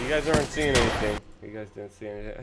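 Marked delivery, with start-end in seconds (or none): 0.0-1.2 loud playful denial. Acted. Telling chat to unsee it.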